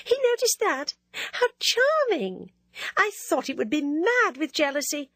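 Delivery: high, gleeful Ginevra; delighted. Acted. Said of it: "she cried with delight".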